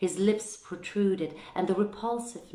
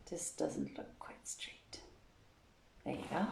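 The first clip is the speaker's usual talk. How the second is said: softer, whispered remark to herself; whispered aside; 10 dB quieter than usual. Real, not acted. Host fixing the camera, whispering to herself. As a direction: murmur it off-mic under the breath.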